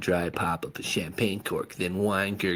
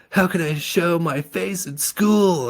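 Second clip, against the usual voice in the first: pleaded; 10 semitones higher, 7 dB louder than usual. A narrator's reading. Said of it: "I pleaded".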